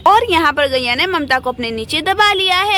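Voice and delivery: story narration. A narrator's reading.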